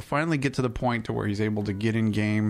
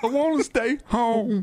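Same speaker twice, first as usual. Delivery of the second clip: high, slow whiny mock sob; whiny crying; 9 semitones higher than usual. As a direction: whine it like a crying kid. Mock whining about work.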